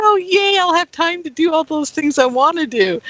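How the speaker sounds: high, bright squeal of glee; cheering at all that free time; excited. Retold or imitated. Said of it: "I was actually getting excited".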